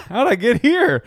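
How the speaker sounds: high, loud cry of confusion; mock bewilderment. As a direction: act out a baffled stoned shopper. Retelling stoned customer's bewilderment.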